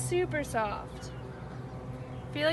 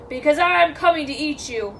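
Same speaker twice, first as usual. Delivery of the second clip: raised, menacing troll voice; mild roar; roaring troll threat; 10 dB louder than usual. Acted. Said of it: "roared the troll".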